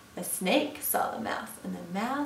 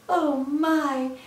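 higher, louder storybook fright; alarmed snake; 5 semitones higher, 6 dB louder than usual. Acted. Snake takes one look at Gruffalo, alarmed. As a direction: exclaim in acted shock.

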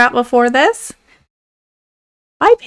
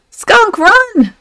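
high, alarmed cry to flee; scared animal yelling at the others to run; panicked warning shout; 9 semitones higher, 3 dB louder than usual. Acted. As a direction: yell the warning in a fright.

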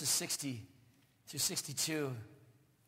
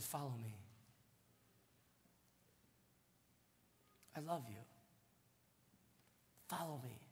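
tender; 2 semitones lower, 8 dB quieter than usual. Acted. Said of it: "He said".

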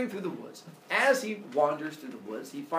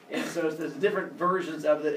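plain reading voice

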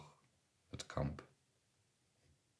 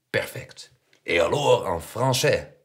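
loud, higher jovial challenge; calling out; 7 semitones higher, 15 dB louder, slower than usual. A narrator's reading. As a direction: call the next test out boomingly. Uncle calls out next typing test. (luide, joviale uitdaging)

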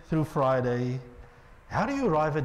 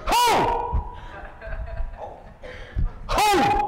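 much higher, ringing call; proclaiming call; 9 semitones higher than usual. Acted. Prophet calling the people to come, much higher and ringing. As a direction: call out to grab everyone's attention. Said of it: "It goes".

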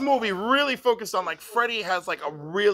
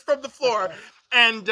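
ordinary delivery

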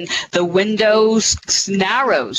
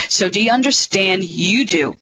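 ordinary talk, nothing marked

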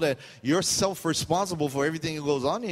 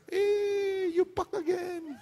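much higher, slow laughing call; laughing high greeting; much slower than usual. Retold or imitated.

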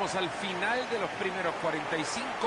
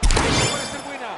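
excited call; 3 semitones higher, 11 dB louder than usual. Real, not acted. Rising chance, loud.

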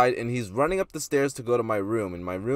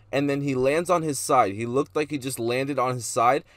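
usual speaking voice

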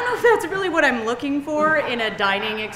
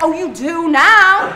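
slow, high, loud comeback; drawn-out smug retort; sassy attitude; 5 semitones higher, 8 dB louder, slower than usual. Acted. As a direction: sass back like a phone with attitude. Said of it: "then it gets an attitude".

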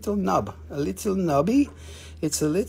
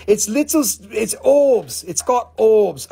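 ORBS hit high and loud; emphatic correction; 5 semitones higher, 6 dB louder than usual. Real, not acted. Seller corrects knobs to orbs, ORBS high and loud. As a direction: punch the word orbs, high and insistent.

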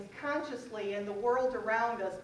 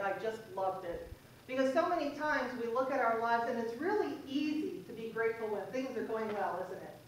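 ordinary delivery

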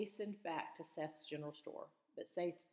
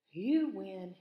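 giving in; 4 semitones higher, 11 dB louder than usual. A narrator's reading. Seth gives in.

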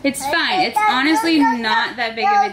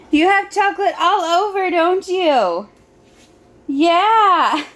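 higher, teasing mommy voice; 6 semitones higher than usual. Real, not acted. Mother teases toddler with chocolate face.

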